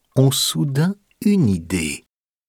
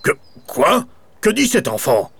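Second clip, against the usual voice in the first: startled baron; 8 semitones higher than usual. A narrator's reading. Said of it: "lève la tête et s'exclame".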